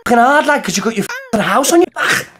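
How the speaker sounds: mocking Scouse. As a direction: sneer at him like a Scouse lad. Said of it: "impression of the man getting out of the car".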